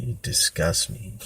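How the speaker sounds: retold quiet contempt; muted disgust. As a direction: say it under your breath to a pathetic brother. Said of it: "I would just look at him like".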